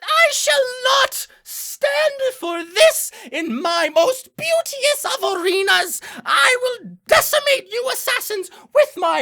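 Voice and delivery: high, slow theatrical declaiming; pompous villain speech in a squeaky voice; grandiose fiend imp. Acted. GM as fiend of avarice facing assassins, pompous declamation. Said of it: "avarice imp stands and will say".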